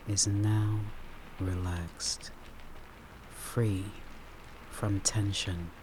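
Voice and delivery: guided meditation. Acted. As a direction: ease the listener into calm, word by word.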